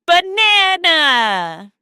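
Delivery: Banana stretched slowly, each syllable long; slow drawn-out word. Shown as a demonstration. Teacher leading kids, a slow drawn-out Banana. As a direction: draw the word out for kids to copy. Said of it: "Now let's say banana together".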